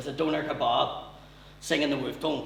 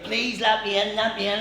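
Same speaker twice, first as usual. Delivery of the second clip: higher whiny begging; mock pleading; 7 semitones higher, 5 dB louder than usual. Dad begging mother to let him in, mock whine. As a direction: beg like a wet puppy.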